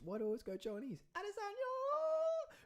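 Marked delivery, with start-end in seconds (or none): 1.2-2.5 very high, slow falsetto cry; a much higher, drawn-out call of the name; squeaky exclamation. Pretend. Silly voice outburst.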